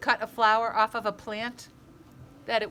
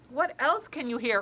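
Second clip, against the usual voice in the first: ordinary delivery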